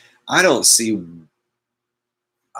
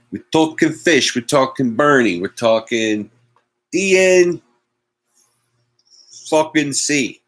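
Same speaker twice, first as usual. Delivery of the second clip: loud, higher mock New York Italian accent; hammed-up Italian-American tough guy; 7 semitones higher, 4 dB louder than usual. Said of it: "I just like doing accents".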